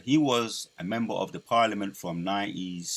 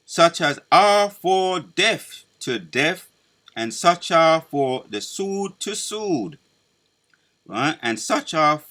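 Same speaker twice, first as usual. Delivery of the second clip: higher, louder, slow solemn proclamation; scripture preaching; 6 semitones higher, 9 dB louder, slower than usual. Acted. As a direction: declaim each verse like a prophet.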